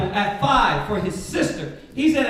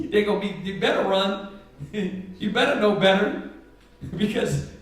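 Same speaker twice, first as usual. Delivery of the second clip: usual speaking voice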